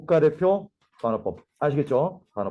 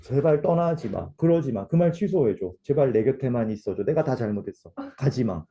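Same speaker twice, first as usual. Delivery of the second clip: heartfelt pleading; 6 semitones lower than usual. Acted. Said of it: "내 진심은".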